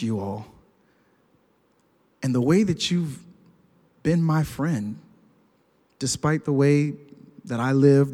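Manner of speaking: low, subdued, heartfelt telling; acted sincere confession. Retold friend's heartfelt confession.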